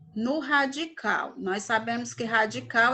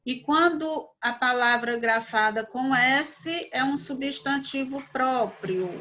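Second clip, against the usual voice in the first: normal talking voice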